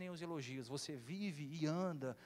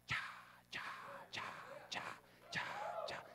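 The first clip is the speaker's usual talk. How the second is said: marching stomps; slower than usual. Retold or imitated. Marching sound effect.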